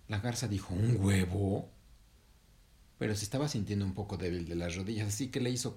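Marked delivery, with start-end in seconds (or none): 0.7-1.7 drawn-out rising disbelief; startled question. Acted. Heron learns she will lay, incredulous question. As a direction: ask it incredulously, rising at the end.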